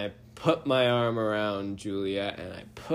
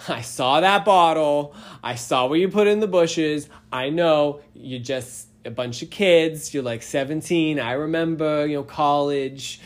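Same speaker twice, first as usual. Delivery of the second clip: put-on Boston cop accent; 4 semitones higher, 6 dB louder than usual. Boston cop scolding drunk teens, loud mock Boston accent. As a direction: lecture the kids like a gruff Boston cop. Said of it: "such a strong Boston accent".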